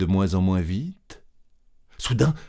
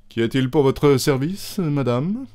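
courteous director; 6 semitones higher, 3 dB louder than usual. Acted. Director politely addressing her.

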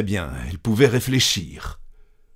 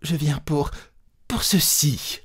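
higher, halting and forced out; a higher, halting struggle to speak; with difficulty; 7 semitones higher than usual. Acted. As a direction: push the words out painfully. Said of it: "Elle articula péniblement".

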